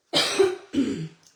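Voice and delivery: a real rough cough. Real, not acted. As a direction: cough hard once. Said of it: "I'm still coughing".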